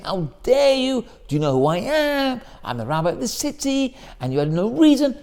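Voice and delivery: hammed-up indignant rebuke; furious. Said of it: "He didn't get furious and say".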